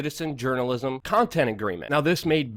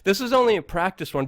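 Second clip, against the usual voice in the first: ordinary delivery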